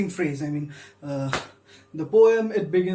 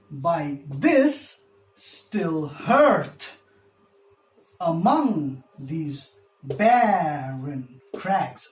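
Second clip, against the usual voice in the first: very slow beat, "this", "hearth", "among" and "barren" stretched; scanned iambic stress; 3 semitones lower, much slower than usual. Shown as a demonstration. Teacher scanning the next line for students, slow stress on every second syllable. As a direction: lean hard on THIS, HEARTH, MONG in "among", BAR in "barren". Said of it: "Let's see the next line".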